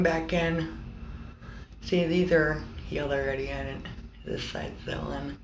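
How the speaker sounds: lip pulled aside with finger; talking while stretching cheek; finger in mouth. Real, not acted. Seen 2: finger hooks lip corner, showing teeth, while she speaks.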